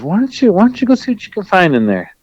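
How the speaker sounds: lightly voiced friend making a suggestion; Rooster's voice. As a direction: nudge him toward the answer. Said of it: "So he goes".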